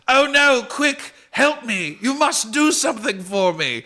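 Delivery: high, loud hammed-up goblin panic; mock panic. Goblin begging the party as bees burst in, hammed-up panic. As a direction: squeal for help, slowed on the plea.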